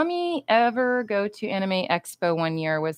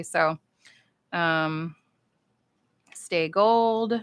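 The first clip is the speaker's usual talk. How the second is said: normal talking voice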